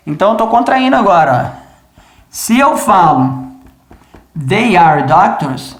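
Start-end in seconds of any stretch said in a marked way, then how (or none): 4.5-5.8 "they are doctors" very slow, each word separate; slow full form. Shown as a demonstration. Teacher contrasting the full form with the contraction, slow. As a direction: spell out "they are doctors" at half speed. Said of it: "agora eu quero acelerar".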